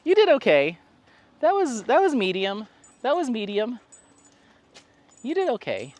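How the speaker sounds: high, loud dog-talk praise; pet praise voice. Real, not acted. Owner grading the dog's climb, high cooing praise. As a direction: lift the voice way up for the dog. Talking to the dog after its attempt.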